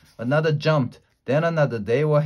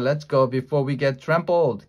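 ordinary delivery, nothing marked

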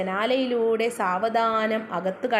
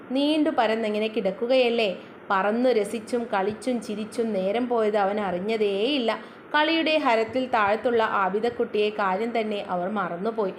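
ordinary delivery

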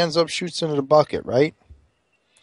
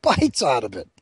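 usual speaking voice

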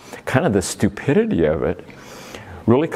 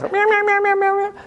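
high squeaky cat meows. Retold or imitated. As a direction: mew like a little cat. Imitating the cat's meow.

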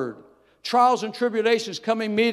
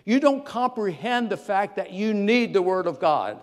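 normal talking voice

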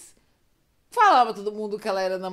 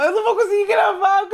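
high, loud wail through laughter; real laughing fit; 9 semitones higher, 9 dB louder than usual. Real, not acted. Storyteller unable to go on recording, loud laughing wail. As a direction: cry it out, cracking up. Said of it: "me deu crise agora".

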